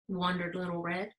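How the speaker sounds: ordinary delivery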